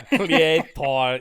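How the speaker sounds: put-on Swedish accent. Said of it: "Creator is nice".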